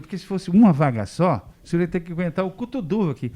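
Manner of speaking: cheeky retort. Retold or imitated. Proud retort to the professor.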